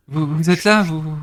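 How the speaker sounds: gasp, then stammer in mild shock; startled villager. Acted. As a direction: caught off guard, stumbling on words. Villager startled at seeing intruders.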